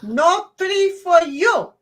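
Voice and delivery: slow, high, hammed-up Indian accent; hammy Indian accent, slowly. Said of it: "my best Indian accent ever".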